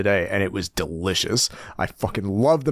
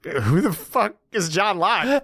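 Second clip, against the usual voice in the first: slow baffled disbelief; 8 semitones higher, slower than usual. Retold or imitated. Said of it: "He's like".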